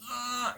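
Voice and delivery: high, soft straining grunt. Retold or imitated. Patient straining to get the pee out, straining grunt. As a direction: squeeze out a small high "uh". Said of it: "straining to".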